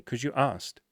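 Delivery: ordinary delivery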